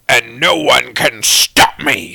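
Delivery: slow, drawn-out villain proclamation; Vilgax. Acted. Villain proclaiming before the hard cut, slow menacing impression. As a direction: declare it slowly like an alien warlord. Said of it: "Vilgax, says".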